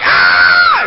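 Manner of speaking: loud imitated shriek of fright; mock terrified scream. Little girl fleeing the man who roared, screaming.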